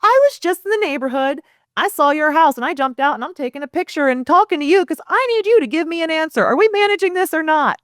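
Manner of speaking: high, insistent demand for an answer; pushy drive-by video. Shown as a demonstration. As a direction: corner them into deciding.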